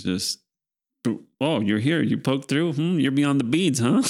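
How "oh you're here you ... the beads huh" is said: alien being voice. Retold or imitated. Voicing transdimensional beings.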